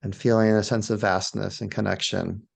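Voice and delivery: low, spacious meditation voice with long pauses; guided meditation. Shown as a demonstration. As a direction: lead them gently outward, one phrase at a time. Leads a guided awe meditation.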